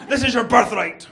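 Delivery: loud, high, hammed-up declaration; grand shouted announcement, played for laughs; mock-epic proclamation. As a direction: proclaim it to a newborn from a mountaintop. Grandma proclaiming to newborn on mountain.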